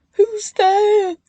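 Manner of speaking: high, loud call into the dark. A narrator's reading. Ben woken at night, calling out loudly. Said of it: "he called".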